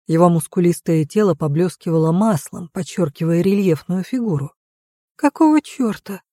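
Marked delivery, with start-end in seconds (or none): 5.2-6.2 startled outburst. A narrator's reading. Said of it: "вырвалось у Лины".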